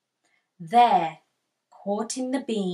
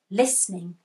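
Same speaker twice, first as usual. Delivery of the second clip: plain reading voice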